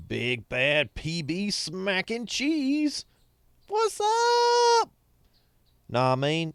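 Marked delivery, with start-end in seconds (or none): 0.1-4.9 slow, higher put-on voice; goofy greeting voice. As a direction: hail a buddy in a silly voice. Greeting a chatter in a voice.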